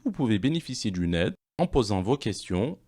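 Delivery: ordinary talk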